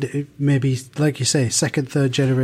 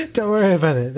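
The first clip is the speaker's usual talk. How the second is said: laughing; 7 semitones higher than usual. Real, not acted. Laughing at host's geography slip.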